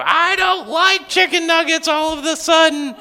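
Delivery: put-on high, whiny child voice; mock whine of a picky eater; whiny kid. Family dinner, whiny child quoted.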